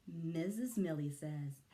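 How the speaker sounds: usual speaking voice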